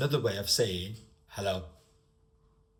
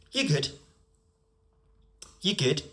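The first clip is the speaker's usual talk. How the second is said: higher-pitched regional British greeting; casual greeting in a local accent; 5 semitones higher, 3 dB louder than usual. Shown as a demonstration. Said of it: "because how it's said".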